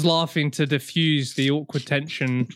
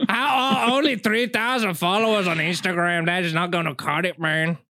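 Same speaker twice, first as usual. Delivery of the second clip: texting voice; 2 semitones higher than usual. Retold or imitated. Friend mimics co-host sneering at follower count, mock texting voice. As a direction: mimic the co-host dismissing someone in a hammed-up voice. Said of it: "That was you, wasn't it?".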